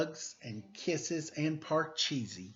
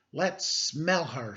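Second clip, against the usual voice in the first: slow teasing; 4 semitones higher, 6 dB louder, slower than usual. A narrator's reading. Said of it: "said Joe".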